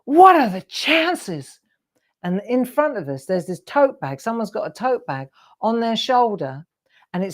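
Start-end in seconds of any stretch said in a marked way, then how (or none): 0.1-1.6 slow, high, loud amazed marvel. Retold or imitated. Man spotting their tote bag in Manhattan, slow amazed exclaim. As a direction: draw it out in wonder. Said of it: "and he goes".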